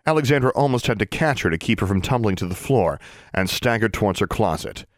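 plain reading voice

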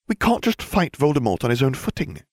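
hissed. A narrator's reading. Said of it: "she hissed".

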